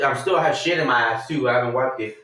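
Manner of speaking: normal talking voice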